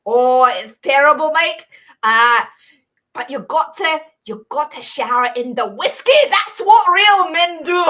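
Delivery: put-on loud, high-pitched old Scotsman with a thick accent; hammed-up Scottish accent, building to a shout; old Scottish guy. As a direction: put on a booming Scottish widower. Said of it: "talking in these, these Scottish accents".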